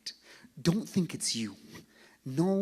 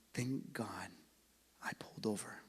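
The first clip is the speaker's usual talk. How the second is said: soft unvoiced whisper of relief; whispered thanks; 2 semitones lower, 7 dB quieter than usual. Believer thanking God on judgment day, whispering. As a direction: breathe it out as quiet gratitude. Imagined grateful words, whispered.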